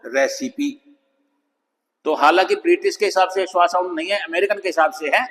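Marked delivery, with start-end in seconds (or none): 0.1-0.7 British रेसिपी with a clear i in the middle, re-si-pee; British pronunciation. Shown as a demonstration. Teacher shows the British way to say रेसिपी. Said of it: "British में बोलेंगे तो".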